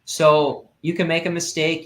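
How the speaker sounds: ordinary delivery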